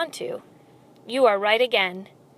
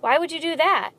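higher, uneasy question; shaking voice; 6 semitones higher than usual. A narrator's reading. Mouse questions the rat's offer, higher and uneasy. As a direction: ask with understated nervousness. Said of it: "His voice shook".

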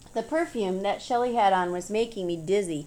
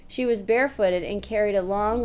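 plain reading voice

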